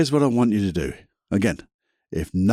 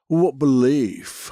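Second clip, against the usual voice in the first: odd drawn-out delivery; 3 semitones higher, slower than usual. Acted. Musing to the listener about beliefs, slowly drawn out. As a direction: stretch out what belief as if pondering. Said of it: "I don't know why I said it like that".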